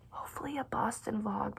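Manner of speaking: soft whispered teaser. Real, not acted. Teasing her next Boston vlog to viewers in a whisper. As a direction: whisper it like a secret plan.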